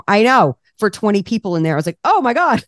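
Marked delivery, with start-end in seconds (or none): 2.0-2.6 high, excited oh my God; amazed gasp. Retold or imitated. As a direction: starstruck realization. Learning Danny Meyer is there.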